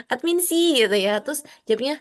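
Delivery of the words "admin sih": higher, louder call of admin sih; mock call across the room. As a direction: call someone's name. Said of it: "dipanggil kayak admin".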